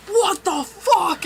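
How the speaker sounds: shouted high and slow, laughing through it; loud slow outburst. Retold or imitated. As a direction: blurt it out loud, dragging each word. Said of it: "all I hear is Pedro go".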